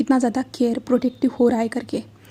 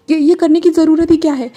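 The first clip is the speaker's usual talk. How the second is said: louder, higher pleading question; tearful protest; 5 semitones higher, 8 dB louder than usual. A narrator's reading. Wing protesting to Wangji, louder and higher through tears.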